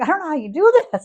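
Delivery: loud, high imitation of distressed husband; mock-horrified high yell; retold incredulous outburst. Husband overwhelmed at Costco asking his wife, mock appalled.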